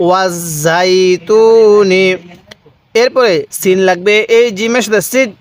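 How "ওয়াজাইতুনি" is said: ওয়াজাইতুনি joined and drawn out very slowly; slow recitation. Shown as a demonstration. Teacher showing students how to read ওয়াজাইতুনি, very slow. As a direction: lay out ওয়াজাইতুনি slowly for repeating. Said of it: "কিভাবে শিখব?".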